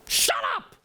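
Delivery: high, sharp yell of a worn-out dad; angry shout. Acted. Father walking into noisy living room, angry shout. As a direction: snap at the noisy kids. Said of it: "he just goes".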